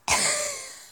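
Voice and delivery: real burst of high-pitched laughter; high squealing laugh. Real, not acted. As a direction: crack up at your own attempt. Cracks up at own accent attempt.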